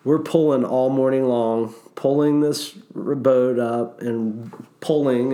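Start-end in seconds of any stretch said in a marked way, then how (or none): none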